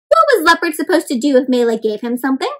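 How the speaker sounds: usual speaking voice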